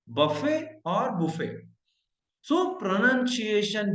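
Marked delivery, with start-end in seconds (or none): 0.1-1.5 बफे and बुफे said slowly, no final t; slow variant pronunciations. Shown as a demonstration. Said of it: "इस word को आप बोलेंगे". (बफे और बुफे को धीरे-धीरे बिना ट के कहना)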